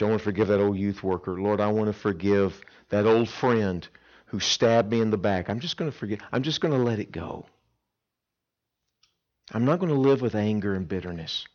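ordinary talk

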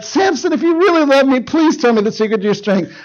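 high put-on begging, easing down; mock pleading. Delilah begs Samson for his secret, mock pleading. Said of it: "she cries, she begs, she pleads".

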